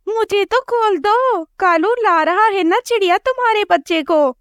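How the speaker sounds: pleading crow wife. Acted. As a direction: beg to be untied. Tied crow wife begs.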